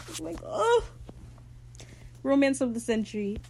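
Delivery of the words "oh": very high, overcome "oh"; swooning squeal. Real, not acted. Fan reacts to the love confession, swooning. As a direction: let out a helpless fan squeal.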